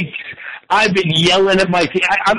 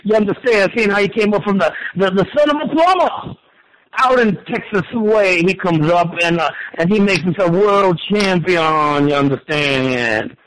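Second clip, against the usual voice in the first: drawling wrestling-legend impression; Dusty Rhodes. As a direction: draw out the phrase endings like a folksy wrestling promo. Impersonates Dusty Rhodes after his mention.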